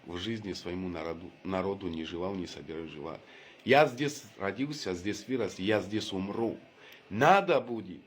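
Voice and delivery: ordinary talk, nothing marked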